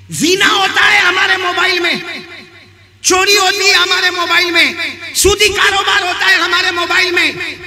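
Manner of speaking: loud, high-pitched sermon denunciation; full-force preaching. Real, not acted. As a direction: hammer out each accusation to the crowd. (पूरे ज़ोर से ललकारती तक़रीर)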